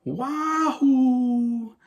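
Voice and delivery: high, drawn-out cowboy holler; rodeo whoop. Retold or imitated. Major Kong riding the bomb down like a rodeo, imitated high whoop. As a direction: imitate a long "wahoo" from a rodeo rider. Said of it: "waving his hat like he's on the rodeo".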